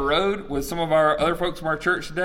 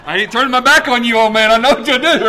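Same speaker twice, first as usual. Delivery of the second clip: loud, high blurted protest; mock wary defiance; 8 semitones higher, 11 dB louder than usual. Distrustful son backing out of the room, loudly wary. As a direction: shout it like a kid backing away.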